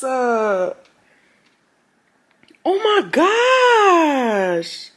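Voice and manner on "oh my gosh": high, slow, falling whine; drawn-out mock-crying lament; mortified wail. Real, not acted. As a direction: let it sink out like a long sob. Mortified by her screenshot.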